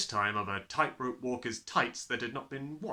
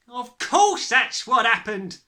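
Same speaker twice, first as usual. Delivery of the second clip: slow, high, loud exclamation; crying out; 12 semitones higher, 12 dB louder, slower than usual. A narrator's reading. Mother seizes on the explanation, crying out. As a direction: cry it out, stretching the words. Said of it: "the mother cried".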